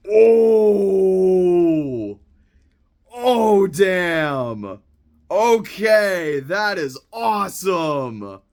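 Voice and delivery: loud, high delighted exclamations, then a slow savoring "awesome"; genuinely thrilled surprise, pitch jumping up; excited gasp. Real, not acted. Unboxing a longed-for 4K for viewers, real thrilled surprise. Opening pack, finds wanted 4K.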